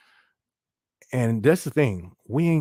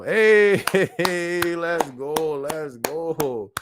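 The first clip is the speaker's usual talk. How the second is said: excited greeting; 4 semitones higher, much slower than usual. Real, not acted. Streamer hypes a viewer joining chat, excited. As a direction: hype up the chat name.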